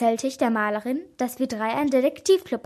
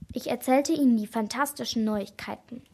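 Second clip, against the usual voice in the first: usual speaking voice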